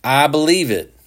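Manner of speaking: insist, a little out of breath; breathless. A narrator's reading. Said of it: "Johnson said breathlessly".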